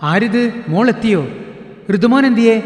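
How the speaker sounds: high, loud, delighted greeting; a mother thrilled to see her daughter home; excited welcome. Acted. (മകളെ കണ്ട സന്തോഷത്തിൽ ഉച്ചത്തിലുള്ള സ്വാഗതം)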